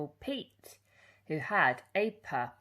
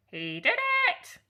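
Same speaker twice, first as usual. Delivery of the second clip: parrot shriek; 6 dB louder than usual. Acted. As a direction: shriek it like a tattling bird. Parrot tattles again.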